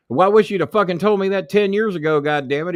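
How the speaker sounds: loud, high mock shout; high, loud retold outburst; retold boss yell. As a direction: bellow it like an exasperated boss. Boss yelling at narrator in story.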